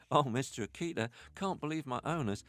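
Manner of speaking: high, delighted puppy. Pretend. Puppy boasting to the Akita about liver treats, high and gleeful. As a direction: gush like a thrilled little pup. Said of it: "you want some doggy voices".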